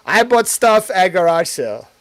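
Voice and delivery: mock title pitch. Shown as a demonstration. Streamer pitching own next video title, loud. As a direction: announce it like a viral video. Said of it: "and see if that hits".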